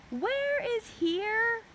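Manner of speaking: very high, slow drawn-out call. A narrator's reading. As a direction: call down from the treehouse. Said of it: "Annie called down".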